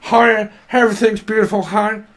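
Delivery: a high, loud, gushing 'everything's beautiful' parody; mock relentless positivity. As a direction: send up fake-happy people in a gushing high voice. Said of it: "People are like".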